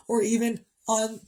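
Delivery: fighting back tears over her late son; crying. Real, not acted. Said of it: "I don't get on here to cry in front of everyone".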